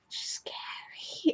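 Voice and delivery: put-on slow, hushed, whispery little-kid voice; tiny girl whisper. Little girl warning her brother, slow soft whisper. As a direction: whisper it softly like a small child. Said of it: "Just this little tiny girl".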